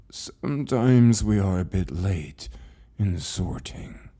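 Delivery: an ancient librarian speaking with patient calm; calm old keeper. Acted. Keeper apologizes to Lucas.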